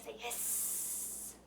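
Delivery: a long voiceless snake hiss. Shown as a demonstration. Teacher showing kids the snake pose with a soft hiss. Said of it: "like a hissing snake".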